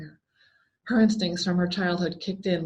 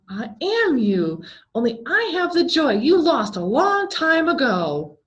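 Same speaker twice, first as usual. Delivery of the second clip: high, louder, slow soothing voice; soothing clown; 7 semitones higher, 5 dB louder than usual. A narrator's reading. Clown revealing itself to Jessica, mildly soothing. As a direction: reassure her like a strange friend. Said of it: "smiled soothingly".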